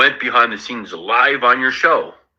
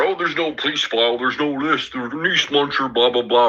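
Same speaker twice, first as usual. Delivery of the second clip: mock Danny; 5 semitones higher than usual. Mocking Danny's claims.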